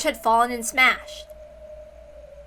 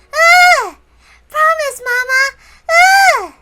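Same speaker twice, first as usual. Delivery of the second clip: put-on high, slow, proud child; a small girl boasting she was good; little girl voice; 12 dB louder, much slower than usual. Little Maria tells Mama she waited, high childish voice.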